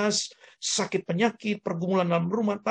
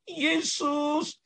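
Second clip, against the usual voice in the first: high-pitched pleading cry for help; acted cry of distress in prayer; crying out to Jesus; 10 semitones higher, slower than usual. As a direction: call out desperately, pitched well up. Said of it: "Ketika kita berteriak".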